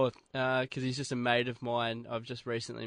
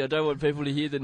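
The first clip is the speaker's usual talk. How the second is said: ordinary talk, nothing marked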